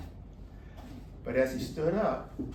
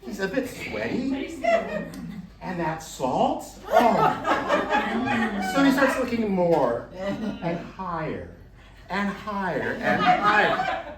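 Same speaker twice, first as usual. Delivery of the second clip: slow, louder, laughing through it; laughing narration; 6 semitones higher, 7 dB louder, much slower than usual. A narrator's reading. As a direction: chuckle at the man's fright. Narrator laughs telling fear.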